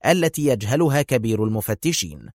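plain reading voice

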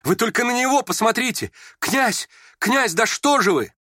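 very high, urgent cry; alarmed plea. A narrator's reading. Frightened friend calling on the prince, pleading shrilly. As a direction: beg the prince in panic to act.

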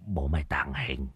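unvoiced whispered mutter. Old Đồng whispering a charm to stay unseen. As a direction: whisper it to yourself, hiding. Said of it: "mồm lẩm bẩm".

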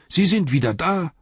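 high, loud exclamation of surprise; excited servant announcing guests; surprised cry. A narrator's reading. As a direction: call out the astonishing news. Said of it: "rief er".

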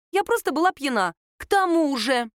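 high, insistent self-defence; exclaiming. A narrator's reading. Lera cutting off Sasha's interruption, exclaiming. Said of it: "воскликнула она".

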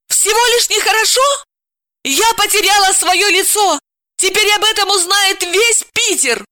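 high, loud outraged wail; furious. A narrator's reading. Dalila raging at her husband's shrug. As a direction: rage at the humiliation. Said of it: "разъярилась Далила".

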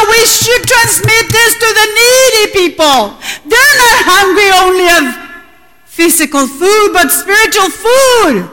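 proclamar más alto y fuerte, con ímpetu; predicación fervorosa. Acted. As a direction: predicar a toda voz a la congregación. (predicación con fervor)

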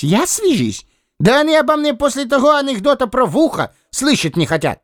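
high, outraged squeak; indignant Lis voice. Acted. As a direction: protest in a high voice.

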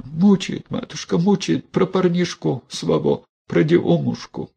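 высоко, с причитанием; тяни жалобно «мучает»; жалобная баба. A narrator's reading. Мелания признаётся игуменье. (Мелания жалобно признаётся игуменье)

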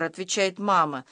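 ordinary delivery

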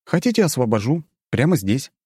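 sweetly threatening offer; good-natured menace. Acted. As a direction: smile while threatening them. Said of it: "добродушная улыбка".

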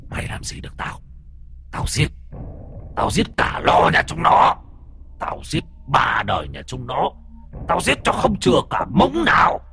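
hissing, shrieking spirit threat; ghost voice. Acted. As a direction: screech it like a vengeful ghost.

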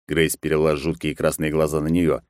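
usual speaking voice

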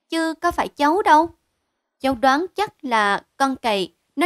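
high, loud youngster's voice; protesting child. Acted. Child blames Cu Lạch for the broken statue, eager protesting child. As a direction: pipe up in your own defence like a child.

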